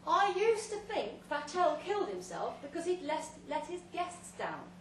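booming tale told through a loud hailer; grand loud speech. Acted. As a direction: hold forth like a host at a banquet. Speech to guests via loud hailer.

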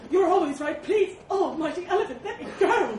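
high, loud pained begging; wincing boar plea. A narrator's reading. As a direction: beg frantically for release. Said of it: "winced the boar".

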